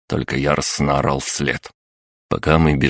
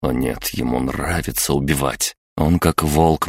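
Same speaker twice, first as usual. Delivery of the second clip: ordinary delivery, nothing marked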